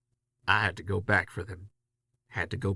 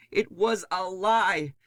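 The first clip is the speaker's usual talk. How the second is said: bitter; 9 semitones higher, slower than usual. Acted. Nix furious at the narrator.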